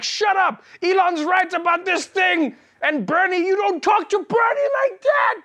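high, slow furious yelling; angry fan shout. Retold or imitated. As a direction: scream at the screen like an enraged fanboy. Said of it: "They're like".

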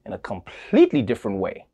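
ordinary talk

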